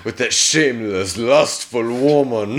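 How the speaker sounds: hammed-up imitation of a Dracula accent; bad Dracula accent. Co-host mimicking the movie's lustful-woman line, bad Dracula accent. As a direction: do a cheesy Dracula accent, drawing out the words. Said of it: "the worst Dracula accent I've ever heard".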